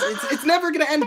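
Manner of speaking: high, loud, laughing through the words. Real, not acted. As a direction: laugh it out in disbelief. Laughing joke that stream never ends.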